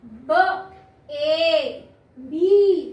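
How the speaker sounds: ब, then long loud ए, then बी; long A sound. Shown as a demonstration. Teacher showing the open vowel's long sound, ब ए बी stretched out. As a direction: sound out "ब ए बी" slowly and firmly. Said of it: "अपना long sound दिया".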